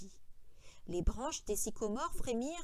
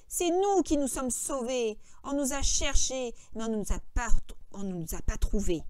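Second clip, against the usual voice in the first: emphatic, irritated retort; annoyed correction; 7 dB louder than usual. A narrator's reading. George correcting Lenny on how they escaped, annoyed. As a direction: set him straight, crossly.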